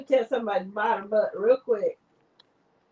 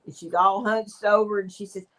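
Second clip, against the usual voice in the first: ordinary talk, nothing marked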